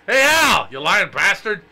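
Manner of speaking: loud, high mock yell at Al; hammed-up yelling heckle; shouted insult. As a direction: bellow it out like a heckler.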